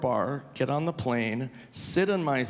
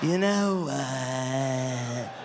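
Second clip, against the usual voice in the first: David Spade calling; 3 dB quieter, much slower than usual. Pretend. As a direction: drawl it like a sly celebrity call. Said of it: "that phone call".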